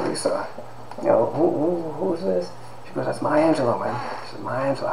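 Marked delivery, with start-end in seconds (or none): 0.1-2.5 much softer, hushed question to a neighbour; quiet whisper at the dinner table; whispered aside. Retold or imitated. Whispering at dinner while Angelou speaks. 3.1-4.9 whispered reply. Retold or imitated. Friend whispers reply at dinner.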